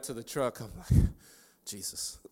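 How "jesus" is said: whispered, winded gasp; breathless, voiceless exclamation; out of breath. Retold or imitated. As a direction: act out gasping after a sprint. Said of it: "I'm out of breath".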